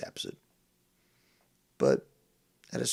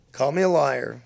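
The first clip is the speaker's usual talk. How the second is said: defiant shout; 5 semitones higher, 10 dB louder than usual. Real, not acted. Defiant challenge.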